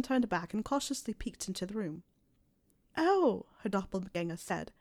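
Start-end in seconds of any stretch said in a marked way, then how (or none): 3.0-3.5 high surprised oh; dawning surprise. A narrator's reading. Doppelganger realizes.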